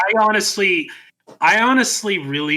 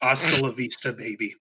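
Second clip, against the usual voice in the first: soft, hateful sign-off sinking lower; Arnold Schwarzenegger; 3 semitones lower, 6 dB quieter than usual. Retold or imitated. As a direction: let it drop away quietly with spite. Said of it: "but he was very hateful".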